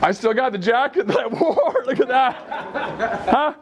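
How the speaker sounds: emotional laugh, voice lifted and wobbling; laughing through tears. Real, not acted. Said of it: "I'm gonna cry".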